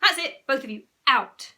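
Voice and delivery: loud, firm command; angry mum laying down the law; fed-up order. A narrator's reading. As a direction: send the children out, patience gone.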